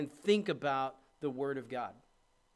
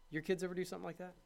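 ordinary talk

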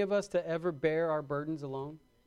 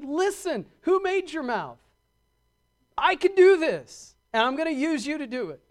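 mock angry God; 7 semitones higher, 6 dB louder than usual.

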